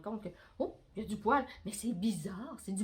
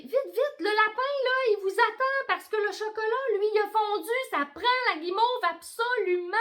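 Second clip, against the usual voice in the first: much higher, louder hurried call; urgent shout; 10 semitones higher, 6 dB louder than usual. Acted. Friends urging them to hurry, high shout. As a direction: call out in a rush. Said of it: "ils crient".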